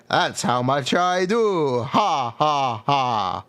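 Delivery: slow counting vampire with a laugh; The Count impression. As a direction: count the damage like The Count from Sesame Street. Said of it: "doing a The Count from Sesame Street impression".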